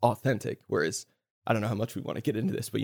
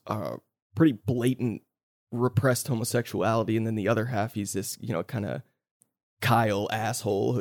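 ordinary talk, nothing marked

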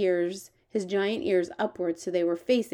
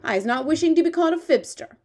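quick, higher, offended protest; loud, indignant and hurried; sharp; 8 semitones higher, 6 dB louder, faster than usual. Acted. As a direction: protest sharply as an insulted giant. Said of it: "the B F G said sharply".

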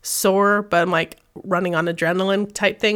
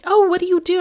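bright, high "oh" of sudden interest; chirpy friendly vendor; perky curious; 11 semitones higher, 4 dB louder, faster than usual. Retold or imitated. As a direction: sound delighted to ask. Vendor asks about her booth.

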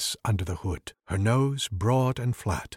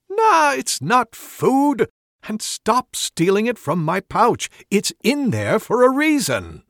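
high, loud, slowed scolding; scolding shout; 10 semitones higher, 6 dB louder, slower than usual. Acted. Wizard scolding the rogue for stealing, loud high shout. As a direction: snap at the thief to stop stealing.

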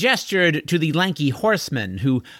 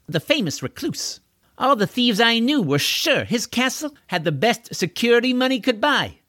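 lightly voiced, higher-pitched know-it-all; self-assured thief; 8 semitones higher than usual. Thief explaining how she robbed the castle owner, lightly cocky. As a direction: explain the scheme eagerly in a raised pitch. Deirdre explains her scheme.

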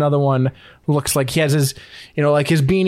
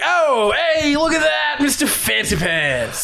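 mocking orcs; 11 semitones higher, slower than usual. Orcs tease the butler who appears, high mocking shout. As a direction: jeer at the fancy newcomer. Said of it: "They're like".

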